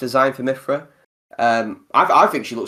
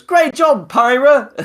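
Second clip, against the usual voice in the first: very high, loud cheer; Rex impression; 13 semitones higher, 7 dB louder, much slower than usual. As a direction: pipe it up high like an over-eager game hero popping up. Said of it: "Rex pops up and goes".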